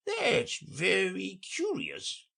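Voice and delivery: high, odd creature voice; a fussy sea creature lecturing; Mock Turtle. A narrator's reading.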